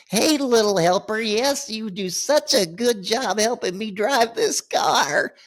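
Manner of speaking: high, sugary praise; singsong baby talk to the wife, high pitched; mock doting husband. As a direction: coo it, teasing the wife.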